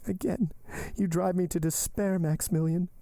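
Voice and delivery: high-pitched anguished heroine; despairing Valentine. Acted. Valentine driven to despair by Maximilian, anguished. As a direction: reproach him high, in despair.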